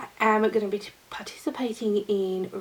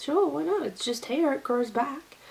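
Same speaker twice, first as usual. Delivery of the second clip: breezy retold reply; 6 semitones higher than usual.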